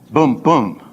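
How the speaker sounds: "boom, boom" as two even thumps; two accents. Shown as a demonstration. Teacher sounding out where accents land, two punched booms. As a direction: beat out each "boom" firmly. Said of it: "accent there".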